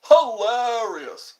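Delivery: loud, high, drawn-out hammed-up word; exaggerated exclamation. Viewer chat about a funny sketch, hammed-up exclamation. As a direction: stretch it out slowly, way over the top. Exaggerated echo of his own remark.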